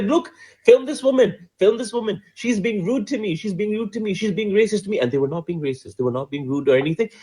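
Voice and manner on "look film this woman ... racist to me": high-pitched imitation of an aggrieved guest; playing up someone's outraged appeal for witnesses; mock indignant complaint. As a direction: rattle off grievances in a high, put-upon voice. Voicing the woman complaining to media.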